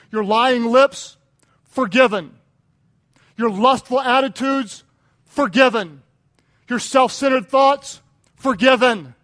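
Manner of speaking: loud, driving proclamation of pardon; full-force preaching. Real, not acted. Preacher declaring the congregation's sins forgiven, full force. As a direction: punch out each promise emphatically.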